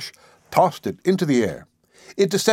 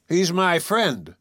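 high, drawn-out indignant protest; slow, mildly indignant, pitched up; 6 semitones higher, much slower than usual. A narrator's reading. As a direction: object indignantly, stretching it out. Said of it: "put in Dean indignantly".

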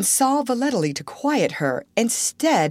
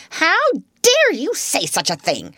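higher, outraged how dare you; indignant exclamation; 10 semitones higher, 3 dB louder than usual. Acted. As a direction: snap it back at the younger sister, scandalised. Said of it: "exclaimed Miss Minchin".

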